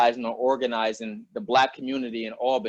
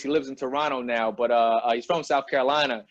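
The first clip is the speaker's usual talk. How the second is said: ordinary talk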